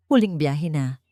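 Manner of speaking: ordinary delivery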